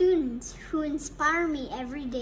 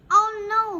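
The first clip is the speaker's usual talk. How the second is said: loud, high shout of dismay; children shouting; 7 semitones higher, 10 dB louder than usual. Acted. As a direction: shout it like a whole class at once. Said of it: "we shout".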